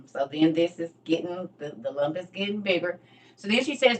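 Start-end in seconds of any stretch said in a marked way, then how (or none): none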